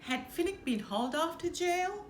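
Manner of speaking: ordinary delivery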